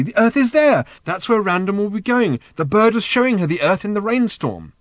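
high, loud, breathless excitement; can't contain the joy; excited. A narrator's reading. Arthur to Ford about Random's destination, excited. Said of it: "said Arthur excitedly".

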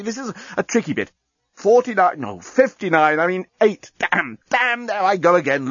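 flustered high machine voice; talking bomb. Acted. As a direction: lose count, get rattled, restart. Bomb flustered, losing count.

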